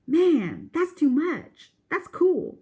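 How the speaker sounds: put-on impressed kid. Teen brother reacting to the dog rescue, high and loud amazement. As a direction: blown away by the story.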